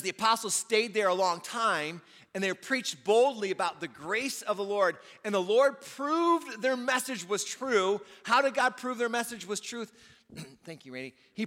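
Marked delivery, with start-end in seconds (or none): 0.0-8.0 loud, high scripture read, slowing at the end; preached scripture reading. Acted. Pastor reading Acts to the church, loud and high. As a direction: proclaim the verse.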